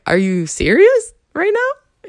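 slow, high disbelieving question; incredulous. Retold or imitated. Replying to the date who loved it, slow high disbelief.

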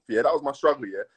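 ordinary delivery

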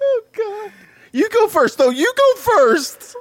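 high, loud panicky squeal; mock scared. Golfer pushing a friend into the horror hole, mock scared.

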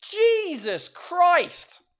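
drawn-out fed-up sigh of a curse; exasperated curse. Shown as a demonstration. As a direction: let it sag out in mock despair. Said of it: "des insultes que j'utilise souvent".

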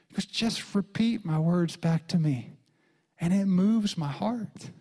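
ordinary delivery

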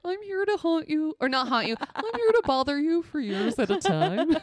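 a demon without the growl, chirpy and giggling; cheery friendly demon. Pretend. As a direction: play a harmless demon greeting a victim. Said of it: "wish that a demon didn't have the growling voice".

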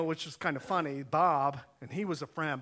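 ordinary delivery

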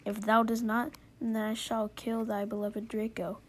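ordinary delivery

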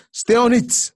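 high, loud preacher's cry; shouted urging. Acted. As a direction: belt it out to the congregation. Preacher urging, sudden burst.

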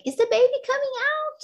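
put-on high, quick child's question; excited little daughter. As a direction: imitate an excited little girl, high and loud. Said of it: "She was very excited".

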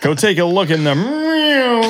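high, hammed-up American, MIRROR smeared long; mock American. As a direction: stretch "mirror" into one American growl-like syllable. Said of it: "I said it American then".

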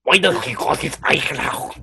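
badly faked German accent, overdone; failed German accent. Shown as a demonstration. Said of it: "I can't do a German accent".

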